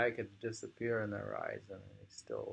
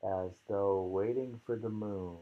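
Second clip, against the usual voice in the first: poem reading; 6 dB louder than usual. Acted. Reads poem, louder.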